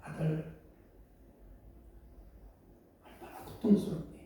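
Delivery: soft, hushed tender words; inner whisper. Acted. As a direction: speak to him in your heart. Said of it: "마음속으로 얘기합니다". (마음속으로 속삭이듯)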